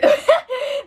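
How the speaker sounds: sharp high laugh of disbelief; high incredulous laugh. Real, not acted. Laughs at false claim.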